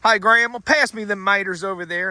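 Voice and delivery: a lightly mocking Florida country voice; country accent. Recalling his country family asking Grandma for something: imitated country accent. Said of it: "I remember growing up hearing things like".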